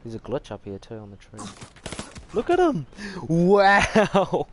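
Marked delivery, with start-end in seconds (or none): 2.3-4.5 high, loud delighted yell; excited shout. Real, not acted. Sees something wild in game.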